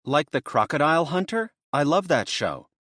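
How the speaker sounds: light up, she loves this show; brightened up. Acted. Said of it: "She brightened up".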